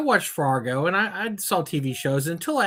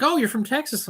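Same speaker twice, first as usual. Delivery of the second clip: higher mock realization; imitating Europeans; 8 semitones higher than usual. People abroad spotting the Texan, imitated realization. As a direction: act out a stranger spotting it. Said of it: "Everybody's like".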